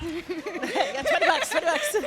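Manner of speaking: high, loud laughing words. Real, not acted. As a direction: repeat it through real laughter. Laughing along at an audience joke.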